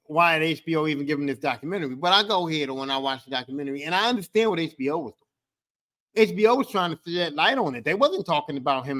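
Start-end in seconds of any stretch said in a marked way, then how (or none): none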